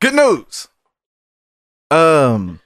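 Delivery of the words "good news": high, hammed-up good-news delivery; mock announcement. Doctor breaking good news to patient, mock announcement.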